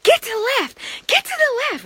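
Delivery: high, loud panicked yelling; freaked-out yell. Retold or imitated. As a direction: scream a warning at her. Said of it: "So I freak out".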